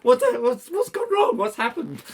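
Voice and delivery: very high, panicked questions; alarmed. Retold or imitated. Answering Rod's call and fearing disaster, high and panicked. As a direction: blurt it out, fearing the worst. Fearing something terrible happened.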